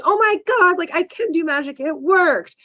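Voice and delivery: squealing high with excitement; little girl delighted at her first magic, pitched high; thrilled kid. Retold or imitated. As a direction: burst out like a kid whose first spell worked. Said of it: "she's like".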